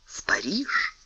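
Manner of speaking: unvoiced whisper. A narrator's reading. Aramis whispers the destination to Porthos, hushed. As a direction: breathe it out secretly. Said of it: "unvoiced whisper, Aramis secret".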